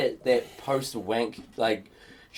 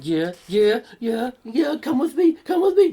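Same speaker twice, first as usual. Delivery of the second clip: building higher and louder, begging yeah yeah, come with me; mock eager pleading; 11 semitones higher, 6 dB louder than usual. Begging him to come home, rising eager squeal.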